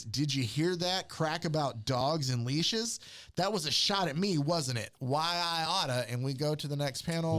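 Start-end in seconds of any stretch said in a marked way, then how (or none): 0.0-6.1 hammed-up indignation, pitched up; mock huff building into an empty threat; in a tizzy. Green Lantern takes offence at the leash crack, in a mock tizzy. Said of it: "Green Lantern in a tizzy says".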